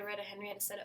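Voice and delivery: ordinary delivery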